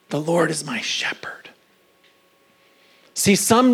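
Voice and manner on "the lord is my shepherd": hushed whisper of the verse. Acted. Whispered scripture in sermon.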